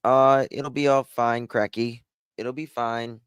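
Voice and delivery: a calm mock Aussie, no drama at all; lightly done Australian accent, totally relaxed; laid-back Australian accent. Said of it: "Australians are the least dramatic people".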